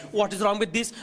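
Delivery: usual speaking voice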